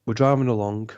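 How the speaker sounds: normal talking voice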